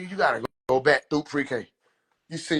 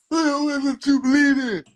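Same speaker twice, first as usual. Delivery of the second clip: high, loud imitation of a challenger; mock challenge; 9 semitones higher, 5 dB louder than usual. Voices other people challenging the speaker.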